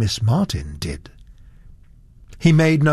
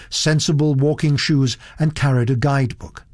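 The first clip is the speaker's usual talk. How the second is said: ordinary delivery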